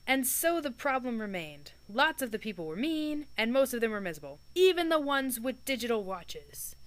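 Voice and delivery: calm storybook reading voice; story narration. A narrator's reading. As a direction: tell it like a book read aloud. Reads the novel aloud.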